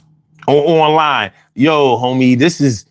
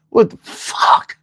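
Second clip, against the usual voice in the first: whispered; slower than usual. Real, not acted. Whispered exasperation.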